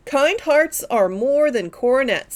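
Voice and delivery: usual speaking voice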